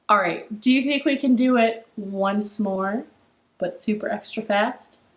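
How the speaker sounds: ordinary delivery, nothing marked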